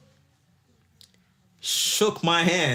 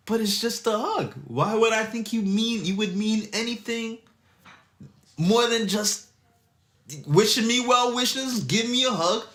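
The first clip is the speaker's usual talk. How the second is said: higher pitched retelling; high mock voice; 8 semitones higher than usual. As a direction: lift the voice up, pleading. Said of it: "I looked at him like".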